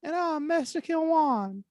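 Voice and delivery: very high, slow squeaky Mexican voice; high, slow cartoon Mexican accent; mock Mexican accent. Shown as a demonstration. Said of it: "establish the accent".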